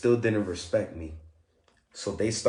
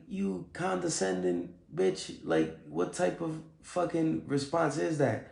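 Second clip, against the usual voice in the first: contemptuous retort; 6 semitones higher, slower than usual. Retold or imitated. Insulted by the guard's answer.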